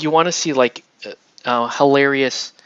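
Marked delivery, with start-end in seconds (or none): none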